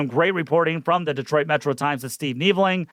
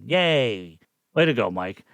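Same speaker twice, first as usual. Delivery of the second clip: sarcastic praise; 6 semitones lower than usual. Acted. Mocking a weak statement.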